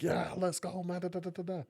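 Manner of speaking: high, hyped-up party voice; extroverted hype voice. Retold or imitated. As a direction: pump it up like the life of the party. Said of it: "I could be extroverted".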